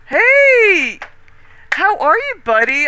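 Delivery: excited greeting. Real, not acted. Excited greeting to new viewer.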